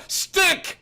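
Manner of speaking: a sudden high outburst; burst out. Acted. Bruce losing patience with Stick, bursting out. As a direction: explode with the name. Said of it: "he burst out".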